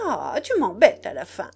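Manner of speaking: loud, swooping "Ah" of annoyance; exasperated impatience. A narrator's reading. Nana tells the boy he annoys her, impatient. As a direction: burst out, fed up with being pestered. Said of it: "reprise d'impatience".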